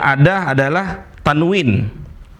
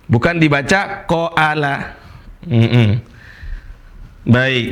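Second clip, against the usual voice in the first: normal talking voice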